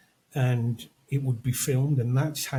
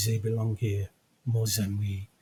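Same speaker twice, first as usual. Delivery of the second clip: put-on French accent; 4 semitones lower than usual. Quoting Truffaut's line from the movie, French accent. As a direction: lower French-accented delivery. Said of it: "he says it in his French accent".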